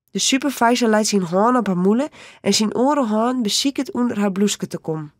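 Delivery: ordinary delivery, nothing marked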